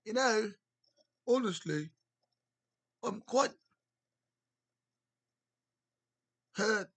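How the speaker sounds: a streamer hamming up a posh British interviewer; mock British accent. Streamer mimicking the British host, mock British accent. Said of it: "he kinda has, like, a British".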